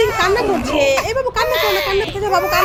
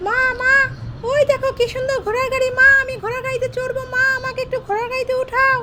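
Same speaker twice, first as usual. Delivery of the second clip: put-on higher, childlike begging voice; pleading little girl; 5 semitones higher than usual. Mother voicing her daughter begging for a horse-cart ride, in a higher childlike plea. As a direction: whine like a small girl who wants a ride.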